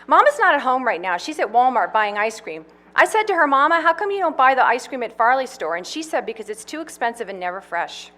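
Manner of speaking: put-on high, chatty girl's voice; little girl Louise. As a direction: prattle on like a small girl.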